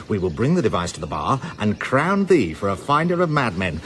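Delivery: usual speaking voice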